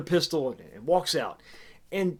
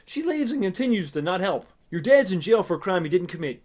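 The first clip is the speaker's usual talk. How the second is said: usual speaking voice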